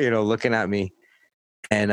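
ordinary talk, nothing marked

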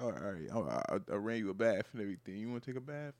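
low, hushed mock sweet-talk; softly butter her up in a low voice; coaxing boyfriend. Boyfriend pampering her before bad news, mock tender.

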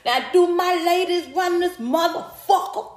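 high, rising hype call in a put-on voice; comedian's crowd hype. Repeating the comedian's line to the crowd, high rising hype call. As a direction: rev up the ladies like the comic did on stage. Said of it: "she used to get up there and say".

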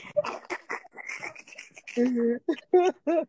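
drawn-out laughing fit; long laugh. Real, not acted. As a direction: let the laugh roll. Laughing at own story.

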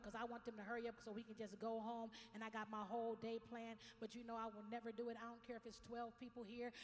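usual speaking voice

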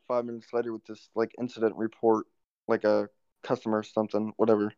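normal talking voice

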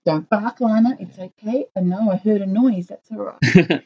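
calm the dog in a hush. Retold or imitated. Quoting a horror film, hushing the dog after a noise.

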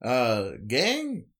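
lilting character voice climbing high; Freddy. Acted. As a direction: rise into a high, uncertain voice. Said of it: "Freddy comes over the intercom and he says".

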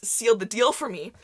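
ordinary talk, nothing marked

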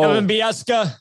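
Don Cherry saying a name. Retold or imitated. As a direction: say the name like the old commentator would. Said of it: "he always says Bieksa".

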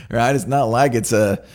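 ordinary talk

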